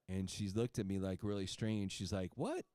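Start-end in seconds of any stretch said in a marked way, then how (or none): 2.4-2.6 high-pitched, incredulous question; mock a patient's disbelief, pitched up. Skeptical patient reacting to the doctor's simple remedy, incredulous.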